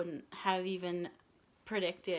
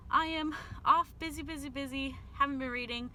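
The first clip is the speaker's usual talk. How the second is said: excited; 6 semitones higher than usual. Real, not acted. Cheerful sign-off.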